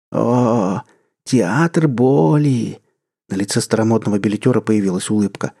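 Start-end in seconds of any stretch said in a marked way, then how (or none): 0.1-2.8 put-on slow savoring old usher. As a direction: savor the name with relish. Old usher relishing name.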